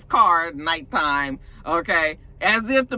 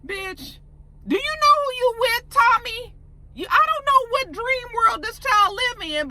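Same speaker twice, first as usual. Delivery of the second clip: much higher, half-crying outrage; exasperated disbelief. Real, not acted. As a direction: wail at her naivety. Viewer exasperated at character.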